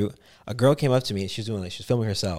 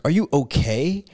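slow, raised concerned question; 5 semitones higher, 4 dB louder, slower than usual. Retold or imitated. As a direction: check on a friend, drawn out. Retold concern for friend.

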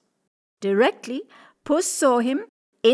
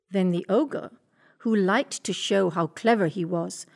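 usual speaking voice

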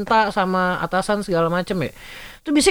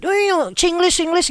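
higher, mock Hong Kong-accented babble; mock Hong Kong English; 7 semitones higher, slower than usual. Telling coworkers how Hong Kong counterparts talk, mock Hong Kong accent. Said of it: "orang Hong Kong ngomong cepet".

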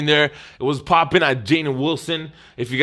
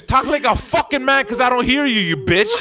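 hammed-up scornful doorman; translated doorman taunt; 8 semitones higher than usual. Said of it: "I'm gonna tell you what that means".

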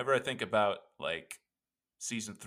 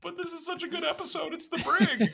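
much higher pleading voice with laughter; mock pleading self; 5 dB louder than usual. Host begging imagined Harrison Ford to keep talking, high mock plea. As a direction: whine to Harrison like a scolded fan.

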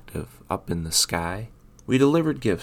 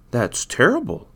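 exclaimed; 5 semitones higher, 5 dB louder than usual. A narrator's reading. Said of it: "exclaimed Santa".

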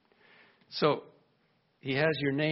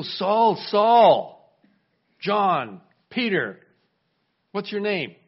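loud calls, each name higher; loud calling; 6 semitones higher, 10 dB louder, much slower than usual. Acted. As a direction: call every name out across the room. God calling each listener by name.

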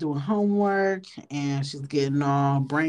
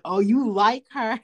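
teasing; 7 semitones higher, 4 dB louder than usual. Acted. Teasing the flustered boy.

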